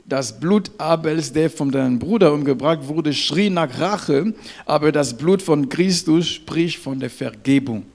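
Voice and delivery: loud, emphatic preacher delivery; forceful preaching. Real, not acted. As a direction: proclaim it to the congregation. (kraftvoll und laut gepredigt)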